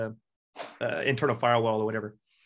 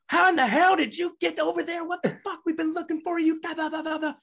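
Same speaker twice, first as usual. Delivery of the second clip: very high-pitched, exasperated mock tirade; mock outraged superior. Master sergeant tracking down missing sergeant by phone, mock outrage. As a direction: imitate a furious superior, pitched way up.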